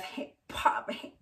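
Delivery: unvoiced, out-of-breath puffing; acted panting. Owner winded from running after the dog, mock panting. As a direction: imitate heaving for air after a run. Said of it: "out of breath".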